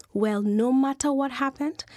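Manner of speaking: ordinary delivery, nothing marked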